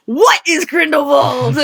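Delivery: high, loud and slow, drawn-out confusion; baffled cry. Retold or imitated. Rewatching the film, the host shouts in sudden confusion. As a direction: blurt it out like a stunned fan. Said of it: "in my head I went".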